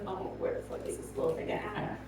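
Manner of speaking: near-whisper, puzzled, soft and breathy; hushed wondering. Retold or imitated. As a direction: whisper it, looking around for the dog. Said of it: "And I was like".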